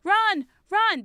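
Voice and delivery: high, loud bursts of alarm; urgent call. Real, not acted. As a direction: urge a quick escape in the game. Fleeing a battle in game.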